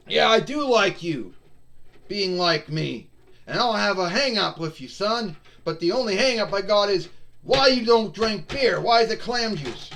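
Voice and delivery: lightly voiced tough wrestler scolding; Steve Austin voice. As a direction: Austin telling Shark Boy to drink beer, gruff. Austin answers Shark Boy.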